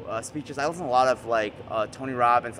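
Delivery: ordinary talk, nothing marked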